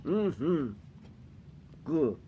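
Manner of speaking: talking while chewing food; tasting food while blindfolded; mouth full chewing. Real, not acted. Seen 0: Food fed to blindfolded man just before; jaw area busy while speaking.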